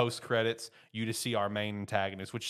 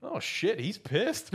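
mock alarmed; 13 semitones higher, 4 dB louder, much slower than usual. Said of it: "It's like".

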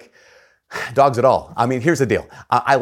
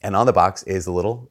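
ordinary talk, nothing marked

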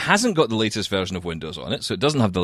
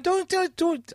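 high squeak; much slower than usual. Real, not acted. Playful protest, squeaky high.

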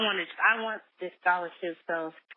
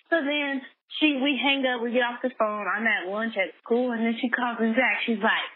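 ordinary talk

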